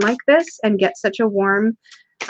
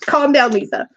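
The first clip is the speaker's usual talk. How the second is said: self-scolding; 5 semitones higher than usual. Real, not acted. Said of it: "Calm myself down".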